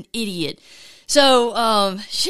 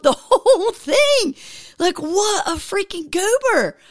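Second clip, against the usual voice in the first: high, slow laughing self-mockery; laughing at himself; 9 semitones higher, slower than usual. Real, not acted. Telling viewers his blunder, laughing high. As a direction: crack up calling himself a goober.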